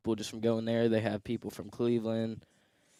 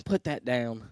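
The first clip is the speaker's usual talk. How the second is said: mild mock yell, a bit louder; retold scream; 5 dB louder, slower than usual. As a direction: imitate mom's scolding shout, half-hearted. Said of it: "She'll start screaming at me".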